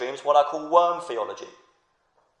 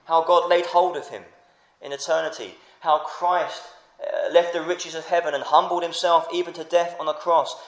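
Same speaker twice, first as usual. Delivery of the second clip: ordinary delivery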